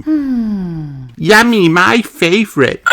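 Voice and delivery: put-on high, happy little girl at dinner; delighted child voice. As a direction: relish the food like a greedy child. Peppa savouring dinner at grandparents.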